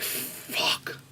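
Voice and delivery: deflated acted whisper; hushed dismay. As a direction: breathe the curse out under your breath. Retelling his dismay as chance vanishes.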